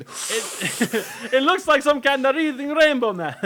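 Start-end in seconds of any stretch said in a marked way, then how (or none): 1.3-3.4 put-on Jamaican accent. Geordi voiced as Jamaican.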